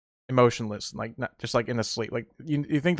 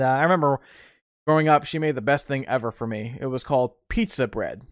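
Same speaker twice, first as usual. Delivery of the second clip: normal talking voice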